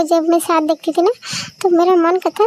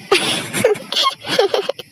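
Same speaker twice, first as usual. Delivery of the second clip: amused laugh; 4 semitones higher than usual. Acted. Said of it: "तुम भी मेरे पर हंस रहे हो".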